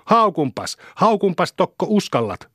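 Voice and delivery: put-on high-pitched boyish daring; defiant taunt. A narrator's reading. Boys bicker over name-calling, defiant taunting. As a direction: dare them in a high, goading voice. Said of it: "uhkui Saku".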